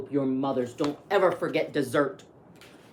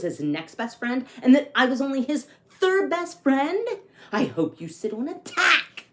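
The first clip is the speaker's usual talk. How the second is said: lively read-aloud storytelling; story narration; 6 semitones higher, 6 dB louder than usual. A narrator's reading. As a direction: read it like a picture book to kids. Reads picture book aloud.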